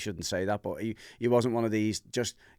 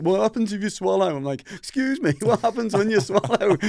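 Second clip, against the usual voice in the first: much higher and louder, frantic; high, loud half-laughing panic; panicked; 11 semitones higher, 7 dB louder than usual. Retold or imitated. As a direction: squeal it out in mock panic. Panicking while retching at ceremony.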